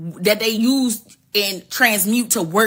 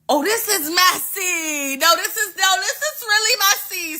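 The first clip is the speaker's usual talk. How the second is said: high, drawn-out mock dismay; scandalized reaction; 7 semitones higher than usual. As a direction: stretch it out high, relishing the gossip. Teller voices own reaction to gossip.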